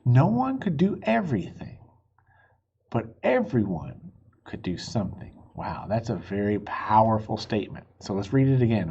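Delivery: ordinary delivery, nothing marked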